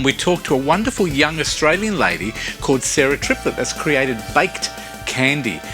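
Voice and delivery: ordinary talk, nothing marked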